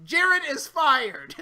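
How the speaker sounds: slow, high words through laughter; laughing. Real, not acted. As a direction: drag it out while laughing. Laughing verdict.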